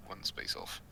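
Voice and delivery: ordinary talk, nothing marked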